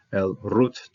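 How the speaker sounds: El Ruth read slowly as one joined phrase; Hebrew reading. Shown as a demonstration. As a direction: join El Ruth together, slowly. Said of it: "the Makef here, joining this".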